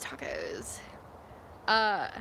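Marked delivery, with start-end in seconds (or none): 0.0-0.8 lower whisper. Real, not acted. Musing for a taco joke.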